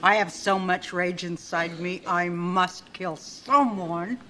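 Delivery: low, slow, brooding rage; murderous patient, low and slow; quiet menacing. Acted. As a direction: let the rage simmer under the breath. Brenda confesses rage.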